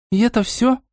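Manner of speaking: understated dismay; disappointed. A narrator's reading. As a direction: ask with mild disappointment. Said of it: "разочарованно спросила Настя".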